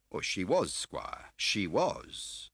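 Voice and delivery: high, slow, unctuous fat man; smarmy dealer playing grand; oily fat car dealer. A narrator's reading. Scar the fat dealer answering Mendel, high slow unctuous voice. Scar's voice held.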